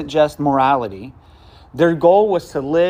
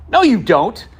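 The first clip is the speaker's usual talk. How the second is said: sharp, loud and high dismissive "No, you don't"; curt no; 7 semitones higher, 4 dB louder than usual. Retold or imitated. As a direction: snap "No, you don't" high and quick as one blunt phrase. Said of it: "like that".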